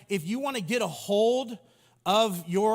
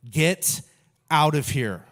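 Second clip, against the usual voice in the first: loud command; 5 semitones lower, 6 dB louder, slower than usual. A narrator's reading. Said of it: "And then he told them".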